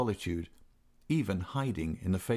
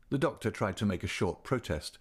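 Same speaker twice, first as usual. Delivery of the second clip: usual speaking voice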